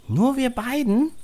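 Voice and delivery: put-on small boy asking, unsure; child voice. A narrator's reading. Narrator voices child Max.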